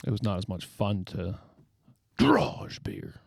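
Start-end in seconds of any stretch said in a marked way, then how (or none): 2.2-3.2 sudden whisper; suddenly hushed. Real, not acted. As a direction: drop to a breathy whisper. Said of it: "Why are you whispering all of a sudden?".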